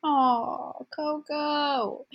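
high, drawn-out adoring coo; cooing over a pet. Real, not acted. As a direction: coo fondly over a tiny dog. Cooing over the other speaker's dog.

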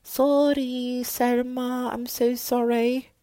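high, loud, squeaky apology voice; exaggerated cartoonish sorry; mock apology. Host apologizing to Salma Hayek for her name, high mock voice. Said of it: "I think that's how you say it".

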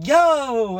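high, loud whoop; excited cheer. Retold or imitated. Host recreating his cheer in the cinema, loud. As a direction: shout it like in the theater. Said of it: "I was going".

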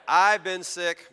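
punchline repeated. Shown as a demonstration. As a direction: deliver the punchline again with a punch. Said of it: "That's my way of telling you".

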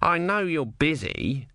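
high, slow sarcastic tortoise. A narrator's reading. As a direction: draw it out with mock understanding. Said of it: "it added sarcastically".

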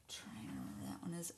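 whisper. Real, not acted. Muttering to herself.

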